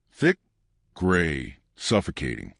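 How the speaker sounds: ordinary delivery, nothing marked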